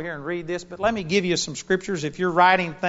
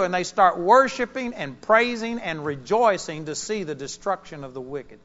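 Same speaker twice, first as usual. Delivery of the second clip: ordinary talk, nothing marked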